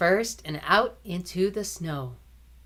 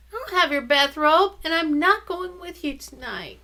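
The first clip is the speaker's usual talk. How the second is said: put-on sick girl refusing; 10 semitones higher, 3 dB louder than usual. Probity refusing brother.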